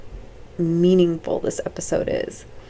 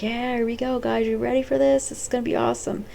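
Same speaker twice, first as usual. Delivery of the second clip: cheerful dad; 6 semitones higher, faster than usual. Retold or imitated. Dad voiced in the daydream.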